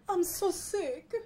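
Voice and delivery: high, whimpering invalid act; feeble, drawn-out mock crying; whiny sick cockroach. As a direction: moan it like a hammed-up invalid. Cockroach plays sick in story.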